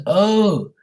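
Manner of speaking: high, drawn-out "oh" of understanding; sudden realization. Retold or imitated. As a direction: imitate someone who finally gets it. Acting lady's sudden understanding.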